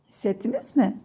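normal talking voice

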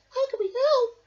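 high, eager squeak; high squeaky mouse. A narrator's reading. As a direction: offer help as a tiny mouse. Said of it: "squeaked Mouse".

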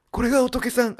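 a voice that won't come out right, half-hearted mimicry; Hiraizumi Sei impression. Gamer at a shrine tries a famous actor's voice, failed mimicry. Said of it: "平泉聖のモノマネしようと思ったら全然出なかった".

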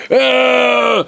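raspy shouted wrestler hype; wrestler promo. Acted. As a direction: hype it like Macho Man. Macho Man wrestler shouting.

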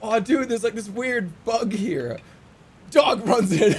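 ordinary delivery

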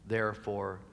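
ordinary talk

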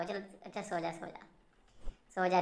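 fading hush, sleep now little one; lulling softly. Real, not acted. (बच्चे को सुलाते हुए बहुत धीमी आवाज़ में)

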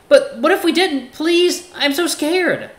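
high, loud mock pleading; hammed-up fear. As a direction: beg in exaggerated terror. Axel pleads in fake fear.

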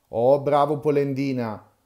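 a teasing shout from an unseen little voice. A narrator's reading. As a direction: cry it out mockingly from nowhere. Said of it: "gridò la solita vocina".

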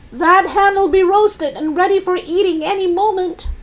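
high-pitched grandma holler; shouting. Acted. Grandma comments on the burning hen, shouting high. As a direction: call down in a very high voice. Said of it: "Grandma shouted".

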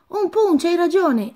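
high, bright burst of sudden enthusiasm; excited exclamation. A narrator's reading. Elsa cutting Christophe off, excitedly exclaiming. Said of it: "esclamò Elsa".

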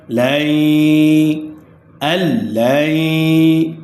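"আল্লাই" slowly, final y held long through the nose; ghunnah. Shown as a demonstration. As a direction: sustain a nasal glide ending "লাই" and "আল্লাই". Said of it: "গুন্নার সহিত মিলাইয়া পড়িতে হইবে".

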